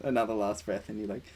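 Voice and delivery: usual speaking voice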